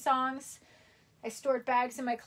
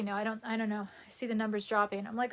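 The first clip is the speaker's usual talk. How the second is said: ordinary delivery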